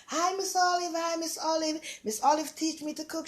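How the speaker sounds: high, bright fan calling out a greeting; excited fans greeting. Retold or imitated. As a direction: hail her like an eager fan on the street. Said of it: "the first thing they".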